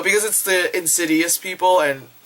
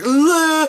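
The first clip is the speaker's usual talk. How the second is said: mock startled yell; 11 semitones higher, 6 dB louder than usual. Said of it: "it's like".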